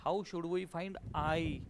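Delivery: आय कहाँ से निकाले drawn out slowly and softly; slow, soft question. Shown as a demonstration. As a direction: ask slowly, nudging the class. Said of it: "आय निघला की answer". (हळू, हळुवार प्रश्न)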